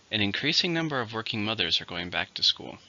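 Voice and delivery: ordinary talk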